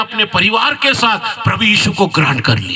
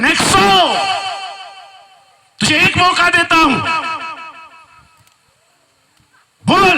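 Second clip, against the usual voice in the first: threatening commander; 11 semitones higher than usual. Acted. Commander threatening the believer, high and menacing. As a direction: lean over him and threaten. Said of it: "सेनापति Nexo को बोलता है".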